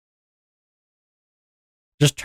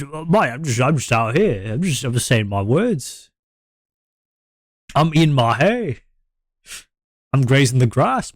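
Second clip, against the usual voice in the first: hammed-up Australian bloke imitation; mock Australian accent; 3 semitones higher than usual. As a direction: put on a rambling, sing-song Aussie accent. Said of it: "your regular Australian accent".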